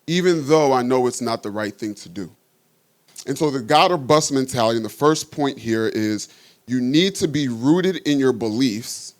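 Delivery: ordinary delivery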